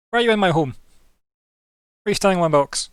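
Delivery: loud, high outraged demand; indignant shout. Acted. GM as vampire yelling at the party in his home, outraged. GM voices angry vampire in coffin.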